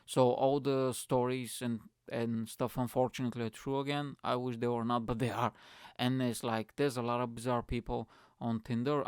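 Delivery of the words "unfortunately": ordinary talk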